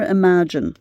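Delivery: usual speaking voice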